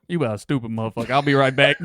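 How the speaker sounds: loud, insulting worker; rude yelling. Acted. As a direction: snap at the customer. Worker roleplay.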